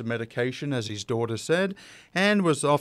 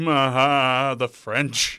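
loud, long drawn-out groaning "Ah"; bad impression of a tired, hungover actor slurring a line; slurred drunken outtake, hammed up; Orson Welles; 5 dB louder, much slower than usual. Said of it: "my bad Orson Welles impression is coming up now".

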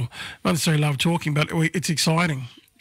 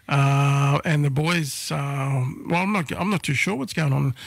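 normal talking voice

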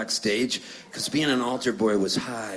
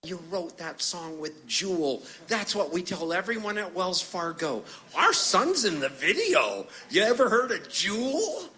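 high-pitched mom voice; imitated proud mom; 6 semitones higher than usual. Mom bragging about her son's song, imitated high voice. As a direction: brag about your son in a high put-on voice. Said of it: "you hear her pick it up".

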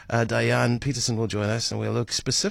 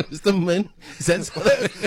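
laughing; 8 semitones higher than usual. Real, not acted. Laughing at the Mister Min joke.

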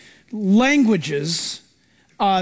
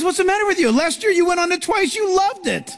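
baffled father; 6 semitones higher than usual. Retold or imitated. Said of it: "And he goes".

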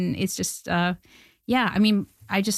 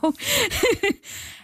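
laughing. Real, not acted. Laughs at own ambition.

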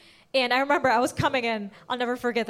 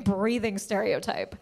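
ordinary delivery

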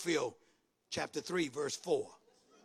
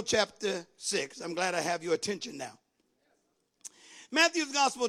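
ordinary talk, nothing marked

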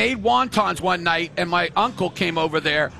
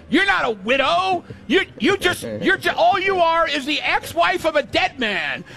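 retold outburst; 8 semitones higher than usual. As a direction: yell it at him, scandalized. Woman lashes out at Larry.

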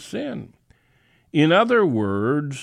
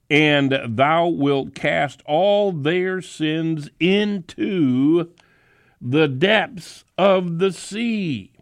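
rising, fervent reading, higher toward the end; scripture reading; 4 semitones higher than usual. Acted. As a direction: build it up to the depths of the sea. Reads scripture.

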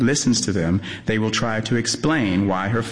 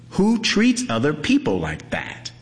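outraged; 6 semitones higher than usual. A narrator's reading. Gleaners' outraged question.

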